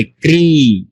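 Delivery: ক্রি with the vowel stretched out long; drawn-out vowel. Shown as a demonstration. Teacher showing students the long ক্রি, drawn out. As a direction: pull the ই in ক্রি out slowly. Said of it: "উচ্চারণটা একটু টেনে বলতে হবে".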